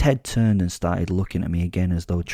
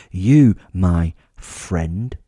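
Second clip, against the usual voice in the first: drawn out, louder taunt; slow mocking emphasis; 7 dB louder, much slower than usual. A narrator's reading. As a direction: lean on each word to mock him. Villain taunts captive.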